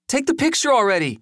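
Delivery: fast, high-pitched impatient order; impatient demand. A narrator's reading. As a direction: rush it out high, fed up with waiting. Joey impatiently orders the photo.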